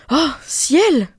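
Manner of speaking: a loud, high exclamation of shock; horrified cry. A narrator's reading. Mother seeing the toads, horrified. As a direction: cry out in dismay. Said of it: "s'écria la mère".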